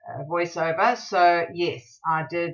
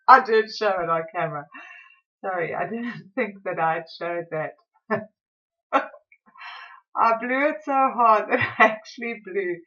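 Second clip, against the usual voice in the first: laughing through the words, higher and louder in bursts; real laughter; 4 semitones higher, 4 dB louder than usual. Real, not acted. Vlogger caught out by her own footage, laughing. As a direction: crack up while owning up to it. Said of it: "sorry, I'm still laughing".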